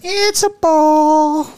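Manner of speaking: very high, slow dog voice; voicing the dog as a thrilled pup. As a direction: squeal it like an excited dog.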